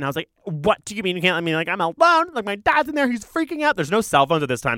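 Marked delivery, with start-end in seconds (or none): 0.4-3.8 put-on higher-pitched, climbing kid's plea; indignant child protest. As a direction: replay a child arguing with growing urgency. Retold child protesting at staff.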